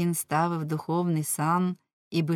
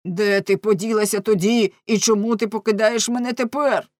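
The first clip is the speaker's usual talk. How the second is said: loud, raised, insistent questioning; crying out; 4 semitones higher, 7 dB louder than usual. A narrator's reading. Abandoned husband demanding answers of his wife, crying out. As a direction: fire the questions out loudly. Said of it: "крикнув Ламе".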